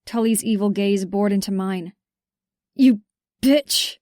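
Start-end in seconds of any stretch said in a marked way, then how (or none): 2.7-4.0 breathless, raised spit of an insult; panted insult. A narrator's reading. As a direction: gasp it out, wounded. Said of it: "he panted".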